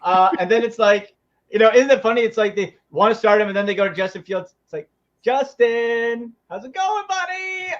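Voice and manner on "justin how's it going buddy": high, drawn-out sing-song call; mock coaxing. Coaches suddenly needing their benched quarterback, mock coaxing. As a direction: sweet-talk him in a hammed-up high voice.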